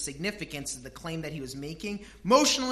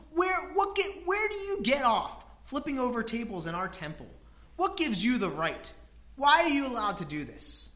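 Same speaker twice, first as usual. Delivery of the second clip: indignant demand; 6 semitones higher, 3 dB louder than usual. Acted.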